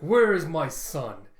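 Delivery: loud, slow bellow; acted commanding roar, drawn out; acted demand. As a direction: boom it out slowly. Kaido demanding his son, acted.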